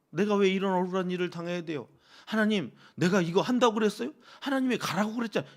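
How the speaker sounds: worked-up pleading complaint; aggrieved Moses complaining. Acted. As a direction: pour out a grievance to God, pressing and fast. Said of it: "하소연을 하는 모세의 마음이".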